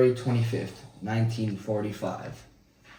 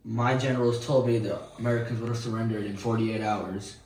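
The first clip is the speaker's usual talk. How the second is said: usual speaking voice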